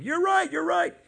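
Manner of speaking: high, loud mock shout; mock shout agreeing. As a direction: shout the agreement like a fake angry God. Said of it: "God is shouting".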